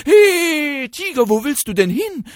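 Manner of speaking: calling fox. Acted. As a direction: shout after the running tiger. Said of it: "rief der Fuchs". (laut rufender Fuchs)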